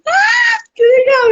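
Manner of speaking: high squeal of excitement, re-enacted; very excited. Guest retelling missions trip with friends, very excited squeal. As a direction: burst out high like a thrilled traveller. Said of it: "Eu tava muito empolgada".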